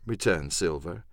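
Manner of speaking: ordinary delivery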